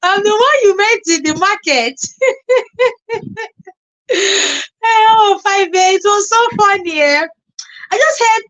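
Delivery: high, loud, words through laughter; laughing excitedly. Real, not acted. Vlogger recalling the funny market meeting, high laughing. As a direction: giggle while telling it.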